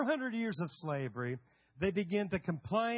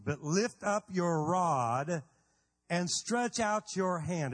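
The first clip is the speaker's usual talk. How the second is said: slow, weighty reading of the verse; scripture reading; 3 semitones lower, slower than usual. Acted. Pastor reading Exodus to the church, slow and weighty. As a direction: read it as holy writ.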